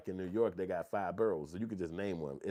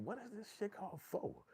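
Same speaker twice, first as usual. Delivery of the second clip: high, soft bewildered voice; much higher, quieter mock confusion; baffled disbelief; 14 semitones higher, 7 dB quieter than usual. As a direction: act utterly confused by the word. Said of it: "And I'm just like".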